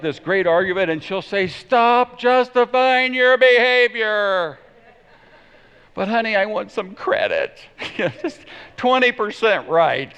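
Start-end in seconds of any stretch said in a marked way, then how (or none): 1.5-4.6 high, loud, drawn-out mock scolding; exasperated wife. Wife stops husband's excuses, mock exasperated yell. 5.9-10.1 high-pitched mock pleading; pleading husband. Husband begs wife for some credit, mock pleading.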